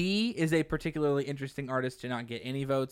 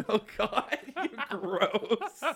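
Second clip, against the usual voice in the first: higher, laughing through the words; laughing disgust; 7 semitones higher, much slower than usual. Real, not acted. Laughing reaction.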